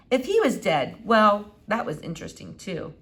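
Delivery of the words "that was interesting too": ordinary delivery, nothing marked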